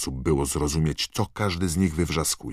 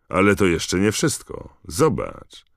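raised, louder showing-off voice; loud gloating; 5 semitones higher, 5 dB louder than usual. A narrator's reading. Malfoy shows Harry the badge's trick, loud gloating. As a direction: show off loudly to a rival.

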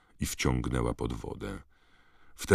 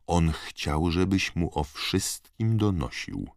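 gravely; 4 semitones higher, slower than usual. A narrator's reading. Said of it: "powtórzyła z powagą".

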